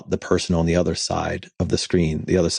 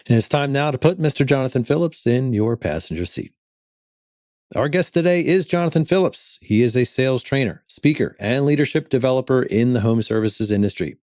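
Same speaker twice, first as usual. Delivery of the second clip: announcer voice; 5 semitones higher than usual. Acted. Introduces the guest.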